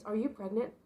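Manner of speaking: usual speaking voice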